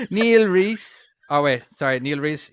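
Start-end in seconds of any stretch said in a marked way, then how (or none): 0.1-1.1 higher, louder put-on Dublin accent. Host reading supporters' names on request, put-on Dublin accent. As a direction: announce the name in exaggerated Dublin accent. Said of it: "You were supposed to get the normal one".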